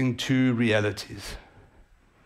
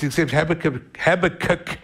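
ordinary talk, nothing marked

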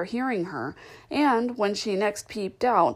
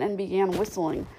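usual speaking voice

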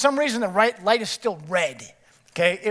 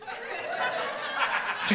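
breathy laugh; 6 dB quieter than usual. Retold or imitated. Laughing in car.

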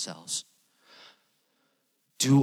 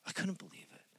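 hushed, breathy repeat of the line; whisper; 7 semitones higher, 14 dB quieter, faster than usual. Retold or imitated. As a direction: say it again under your breath, unvoiced. Teacher's quote repeated twice.

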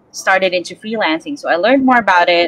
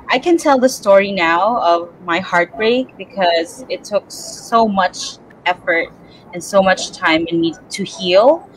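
ordinary talk, nothing marked

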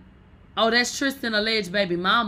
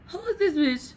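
high surprised question; 9 semitones higher than usual. Real, not acted. Baffled at page.

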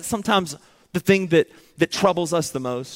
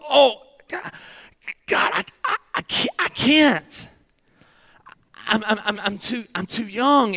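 cracked and much higher, halting, stammered repeats; crying out in pain; 5 semitones higher than usual. Acted. Jeremiah crying out to God that he is too young, anguished. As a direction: plead with God, voice breaking on each "I". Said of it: "he's crying out in pain".

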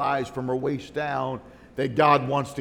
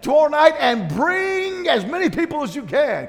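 high, loud preaching promise; fervent shout; 11 semitones higher, 7 dB louder than usual. Retold or imitated. Pastor's wife promising the congregation a healing, shouting. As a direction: proclaim it to the crowd. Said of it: "she said".

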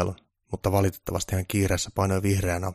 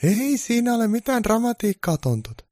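high, calm Mrs Claus voice; Mrs Claus explaining; 4 dB louder than usual. Acted. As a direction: play a calm wife explaining. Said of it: "joulumuori alkoi selittämään".

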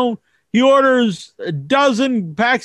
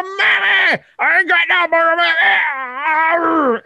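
higher mock wail with a fake sob; crying kid; 6 semitones higher than usual. Kid wailing to mom on the way home, hammed-up crying. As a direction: bawl like a spoiled kid. Kid cries, candy gone.

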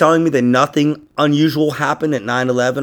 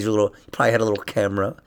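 ordinary talk, nothing marked

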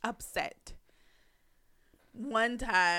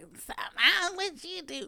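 higher-pitched mock challenge; mock confrontation; 8 semitones higher than usual. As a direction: imitate people asking what's wrong. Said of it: "they be like".

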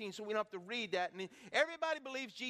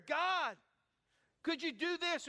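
pleading prayer; 6 semitones higher, slower than usual. Acted. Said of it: "everyone has prayed a prayer".

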